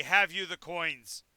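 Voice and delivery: loud, slow, solemn ferryman; commanding otherworldly voice, drawn out; boatman of the dead. Acted. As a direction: boom out the demand slowly. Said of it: "you hear a voice say".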